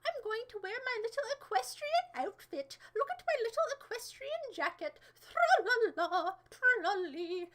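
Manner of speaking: high, prissy mocking show-off voice; rich horse girl. Streamer mocking rich horse girls, high prancing impression. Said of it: "must just be a rich kid horse girl".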